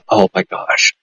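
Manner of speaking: soft whispered oh my gosh; whispered amazement; whispered awe. Retold or imitated. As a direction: breathe it out in disbelief. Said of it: "I was going".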